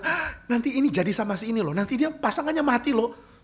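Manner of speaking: excited drama fan. Retold or imitated. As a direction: gush like a hooked drama fan. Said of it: "orang yang suka akan bilang". (penggemar drama yang bersemangat, suara tinggi dan keras)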